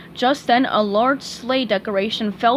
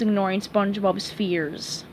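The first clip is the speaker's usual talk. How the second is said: ordinary delivery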